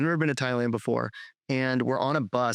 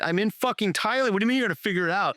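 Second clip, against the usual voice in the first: higher, fast, incredulous retold rant; exasperated outburst; 7 semitones higher than usual. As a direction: fire it off fast in disbelief. Stranded, financing lost, retold outburst.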